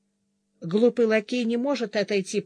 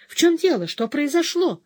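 alarmed; 7 semitones higher, 4 dB louder than usual. A narrator's reading. Alarmed guest questions.

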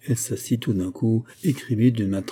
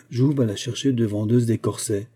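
ordinary delivery, nothing marked

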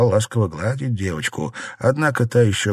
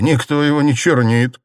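louder, higher rebuttal; firm objection; 6 semitones higher, 5 dB louder than usual. A narrator's reading. Husband rejects his wife's accusation, objecting. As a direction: object firmly. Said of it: "возразил Цзялянь".

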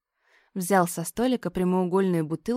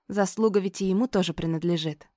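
ordinary delivery

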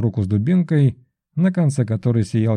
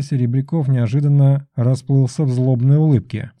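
ordinary delivery

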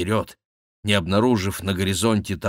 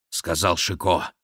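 ordinary delivery, nothing marked

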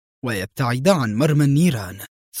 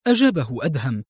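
usual speaking voice

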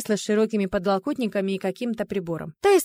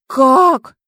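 much louder, higher astonished question; blurted in chorus; 5 semitones higher, 11 dB louder than usual. A narrator's reading. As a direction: burst out with a baffled cry. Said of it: "хором спросили рыжики".

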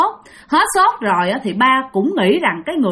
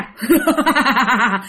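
eerie chuckle of the stranger; spooky imitated laugh; creepy laugh. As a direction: laugh like the man blocking the way. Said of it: "Cứ cười vậy và hoàn toàn không có nói gì cả mà chỉ cười".

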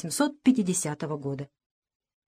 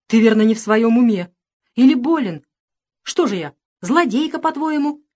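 louder, affronted mother; indignant retort; 3 semitones higher, 6 dB louder than usual. A narrator's reading. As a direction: answer the charge with outrage.

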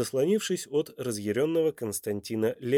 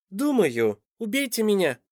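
put-on high-pitched little boy; loud child voice; 9 semitones higher than usual. A narrator's reading. Boy interrupts the adults, high piping child voice. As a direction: pipe up unexpectedly as a child. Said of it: "неожиданно громкого детского голоска".

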